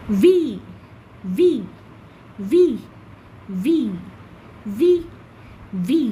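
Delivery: "ভি" repeated, spaced and firm; teacher repeats the letter V; letter V drilled. Shown as a demonstration. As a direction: drill "ভি" for the class to copy. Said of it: "teacher models letter V".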